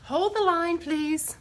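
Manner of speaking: hammed-up on-hold announcement; mock phone operator. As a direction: sing it out like a switchboard operator. Mock phone hold while moving camera.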